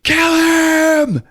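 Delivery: very high, loud mock scream; hammed-up scream from hiding. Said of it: "and screams".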